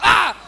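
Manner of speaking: loud high scream. Retold or imitated. Scarred drawing figure screaming, loud wild scream. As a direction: let out a wild monster scream. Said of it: "va gritando".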